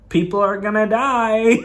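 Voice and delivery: high-pitched mocking quote of a warning. Fan quoting the director's false warning, mock warning.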